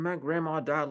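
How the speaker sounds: ordinary delivery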